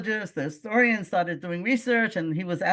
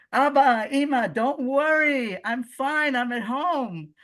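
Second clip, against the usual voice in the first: higher and louder, slowing on don't worry; reassuring message; 7 semitones higher, 4 dB louder, slower than usual. Retold or imitated. Grandson in Gaza reassuring his parents, high and loud calming. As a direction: call out to soothe worried parents.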